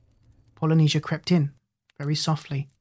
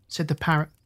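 ordinary delivery, nothing marked

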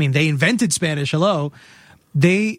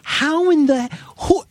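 incredulous; 12 semitones higher, slower than usual. Retold or imitated. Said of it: "I was like".

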